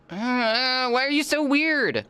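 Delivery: very high, slow squeaky pet voice; high pet-talk voice. Real, not acted. Asking her cat why it is weird, high slow squeaky voice.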